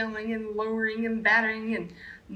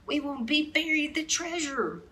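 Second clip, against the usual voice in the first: pirate captain; 8 semitones higher than usual. A narrator's reading. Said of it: "hollered Braided Beard".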